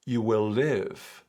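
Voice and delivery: slow, lofty profound-sounding voice; guru parody. Pretend. Mocking spiritual gurus with slow lofty vagueness. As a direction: intone it like a self-help guru.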